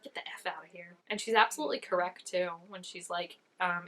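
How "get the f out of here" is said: hushed whisper, no voice at all; whispered quote. Retold or imitated. Viewer whispers the queen's dismissal under her breath. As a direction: whisper it like a secret curse.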